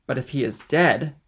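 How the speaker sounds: loud, pointed challenge; tense challenge through clenched teeth. A narrator's reading. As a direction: press the question hard on the last word. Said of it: "with clenched teeth and expanded nostrils, said".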